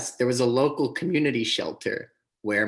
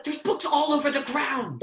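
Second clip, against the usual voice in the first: very high outraged shout; furious yell. Acted. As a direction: demand who did it. Angry at spilled books.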